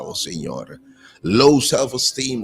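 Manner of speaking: usual speaking voice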